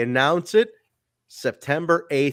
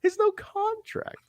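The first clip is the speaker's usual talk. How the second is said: mock claim. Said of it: "So it's like".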